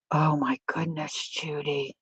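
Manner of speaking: breathy hush at the friend's name, almost whispered. Real, not acted. Reading a friend's good news, hushed whisper. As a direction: whisper her name, overcome.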